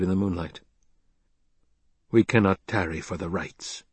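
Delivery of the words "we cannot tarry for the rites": plain reading voice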